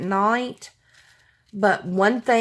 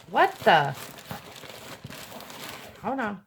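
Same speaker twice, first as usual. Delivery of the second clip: normal talking voice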